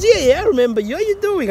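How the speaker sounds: a hint of a chirpy young officer; young cop friendly. Young cop answers his call, lightly voiced cheerful greeting. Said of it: "And he goes".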